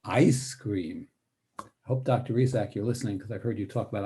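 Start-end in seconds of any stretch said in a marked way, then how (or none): 0.0-1.1 "ice" stressed and lengthened, "cream" falling low; savoring "ice cream". Shown as a demonstration. Teacher makes the words tasty, drawn-out stress on "ice cream". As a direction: relish "ice cream" as if tasting it. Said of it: "in such a way that people can taste it".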